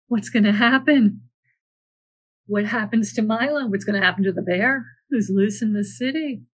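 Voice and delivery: plain reading voice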